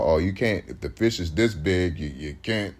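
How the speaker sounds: mock-stern refusal from the authorities; Esau voice. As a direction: growl out the rule like a scolding authority. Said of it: "But Esau".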